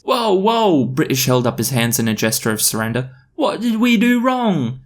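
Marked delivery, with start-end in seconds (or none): 0.0-0.8 high-pitched startled protest; alarmed protest. Acted. British surrendering to officers, alarmed. 3.3-4.8 very high, drawn-out plea; protesting whine. Acted. British protesting to the police, high pleading.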